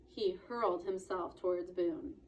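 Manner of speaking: ordinary delivery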